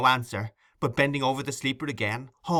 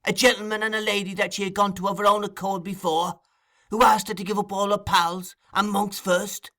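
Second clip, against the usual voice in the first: high, relentless, incensed tirade; half mad with fury; 7 semitones higher than usual. A narrator's reading. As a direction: hammer through the charges in a high voice. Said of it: "half mad with fury".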